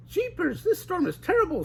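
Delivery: high cartoon girl, worried; high worried Daphne. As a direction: fret about the storm. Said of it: "said Daphne".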